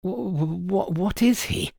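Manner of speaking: high, stuttering, bewildered question; flustered assistant struggling to ask; stammering. A narrator's reading. Baffled assistant stammers his question.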